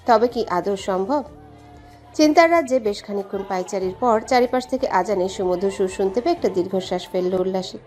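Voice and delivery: ordinary talk, nothing marked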